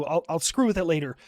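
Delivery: ordinary delivery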